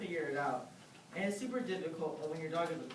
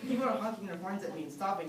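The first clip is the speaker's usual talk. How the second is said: ordinary talk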